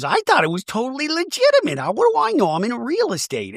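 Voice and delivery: high-pitched mock protest; high imitation of a flustered housewife; mock defensive. Acting out Gina's defence.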